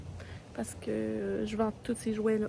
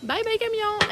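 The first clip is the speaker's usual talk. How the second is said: bright high singsong farewell to a truck; high singsong bye; 10 semitones higher than usual. Real, not acted. Mother waving at truck with child.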